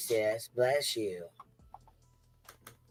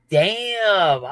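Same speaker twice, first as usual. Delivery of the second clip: laughing damn; 8 semitones higher, 4 dB louder than usual. Real, not acted. Caught out, laughing.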